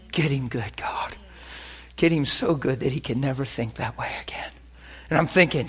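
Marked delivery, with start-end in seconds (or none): 0.1-4.5 hushed, breathy urgent whisper; whispered prayer. Retold or imitated. Pastor praying silently for his weeping friend, whispered. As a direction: whisper it under your breath to God.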